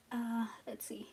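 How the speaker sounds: unvoiced whispered aside. Real, not acted. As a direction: breathe it half to yourself.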